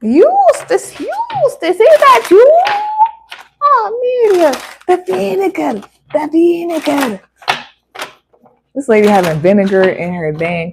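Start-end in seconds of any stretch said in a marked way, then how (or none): 0.0-2.6 very high, louder calling voice; calling old woman. Acted. Character calling out for Eustace in a very high voice. 3.6-7.2 put-on calling old woman. Character calling out for Eustace in a very high voice.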